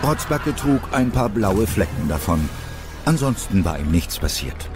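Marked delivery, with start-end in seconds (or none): none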